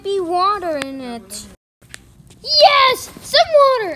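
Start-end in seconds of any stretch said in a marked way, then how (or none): 2.4-3.9 high loud triumphant cheer; loud happy shout; excited cheer. Pretend. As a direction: celebrate the find. Finds water, celebrates.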